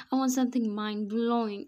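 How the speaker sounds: hammed-up fake cry. A narrator's reading. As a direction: whine with a put-on cry. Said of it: "she fake cried".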